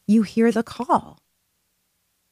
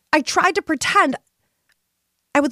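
usual speaking voice

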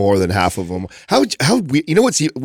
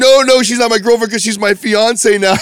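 higher and louder, laughing through the punchline; laughing reveal; 10 semitones higher, 4 dB louder than usual. Retold or imitated. Guy telling his buddy he's engaged, said high and laughing. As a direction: spill the twist while cracking up. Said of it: "he's like".

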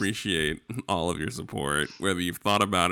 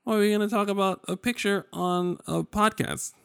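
much higher, louder, squeaky disbelief; incredulous mock voice; 4 dB louder than usual. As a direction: scoff at the idea in a squeaky voice. Mocking the picture-on-podcast idea.

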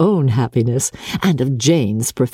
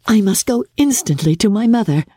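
put-on higher, bright young sister; a young woman bursting with news; Jane's eager voice; 8 semitones higher than usual. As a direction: rush to tell her mother. Jane speaks to Elizabeth.

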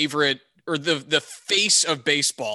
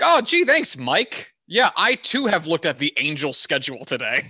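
sarcastic thanks; 3 semitones higher, 3 dB louder than usual. Acted. Fan replying to Mike Trout's travel tweets, sarcastic. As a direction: gush with fake gratitude, then deadpan the punchline.

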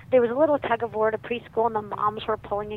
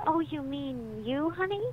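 slow, higher-pitched, lilting question; tender father; 5 semitones higher than usual. Retold or imitated. Dad playfully checks his daughter's idea, tender. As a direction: coax the child with a rising, affectionate honey.

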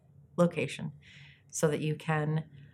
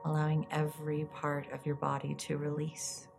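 soft spa whisper; 7 dB quieter than usual. Acted. Leads a guided meditation.

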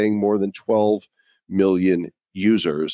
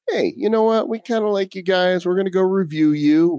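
imagined listener; 8 semitones higher than usual. Pretend. Voicing a listener's thought.

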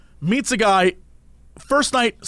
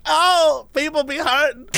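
high, put-on sassy voice; lightly hammed-up sassy girl, higher; mock sassy girl; 7 semitones higher than usual. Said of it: "She's like".

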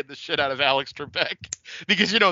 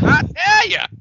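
much higher, louder Cornette yelp; imitating Jim Cornette; 9 dB louder, slower than usual. Mocking the co-host's Cornette voice. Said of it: "You just went".

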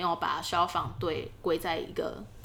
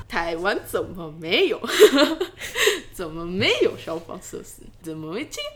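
put-on Japanese accent in Mandarin; 7 dB louder than usual. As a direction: play a Japanese official, laughing. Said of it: "我想要学日本腔". (日本腔)

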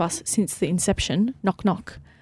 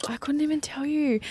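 whispered aside, repeating the line; a real whispered echo of her own words; 7 semitones higher, 6 dB quieter than usual. Real, not acted. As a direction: drop to a breathy whisper for emphasis.

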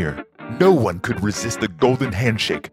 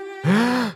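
high mock gasp; 12 semitones higher than usual.

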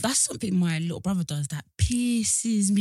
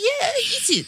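laughing mum; slower than usual. Retold or imitated. Mum granting the pepper puff.

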